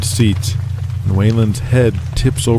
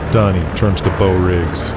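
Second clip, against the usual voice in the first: plain reading voice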